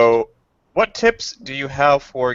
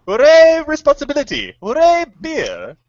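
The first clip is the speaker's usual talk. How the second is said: mock cheer; 6 dB louder than usual. Retelling beer ad ending, hammed-up triumphant cheer. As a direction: cheer like a goofy ad mascot. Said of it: "He's like".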